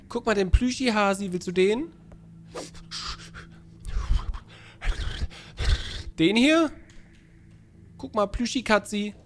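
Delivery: put-on high-pitched cooing to a baby; high, playful babysitter voice; coaxing baby talk. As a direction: tempt a baby with a toy. Coaxing baby with plush toys.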